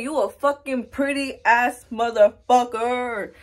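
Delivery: slow, drawn-out self-praise; emphatic hype-up. Acted. Vlogger talking herself up in the mirror, slow emphatic hype. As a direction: stretch every word, hyping yourself up.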